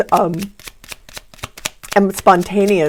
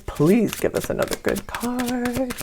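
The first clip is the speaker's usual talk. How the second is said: pleading; 4 semitones higher, 6 dB quieter than usual. Real, not acted. Tarot reader asking angels and guides for a card, soft pleading. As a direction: gently beg for a favour.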